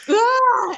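high, loud cry of alarm; re-enacted scream. Rider watching horse reach the canal edge, re-enacted scream.

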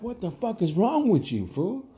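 high-pitched incredulous scolding; retold exasperation. Bouncer telling off his show-off partner, exasperated. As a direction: scold him in a disbelieving high voice.

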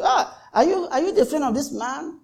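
high, soft, casual asking; an idle curious servant poking at Peter; innocent question. Retold or imitated. Servant girl casually asking Peter, high and soft.

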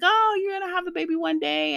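high, chirpy imitation of a cheerful well-wisher; sing-song mimicry of people saying she'll have a baby someday; mock sing-song. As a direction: parrot the breezy reassurance in a mocking lilt. Mocking what others told her.

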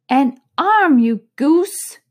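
high, loud scolding of a servant; angry scolding. Acted. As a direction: snap at the foolish servant. Rabbit scolding Pat.

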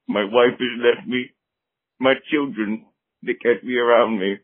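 ordinary talk

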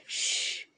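single voiceless shushing hiss; soft "shh" with no voice in it. A narrator's reading. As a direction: hiss one long hush. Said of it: "hissed Gollum".

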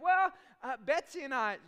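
high and loud, bubbling over; excited wife. Retold or imitated. Wife starting to explain the house to her husband, excited. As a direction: rush in with the story. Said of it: "exuberant and animated".

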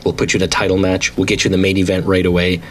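usual speaking voice

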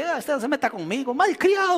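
high-pitched scolding mother, mildly mocked; imitating angry mother. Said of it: "me decía". (madre regañando con voz aguda imitada)

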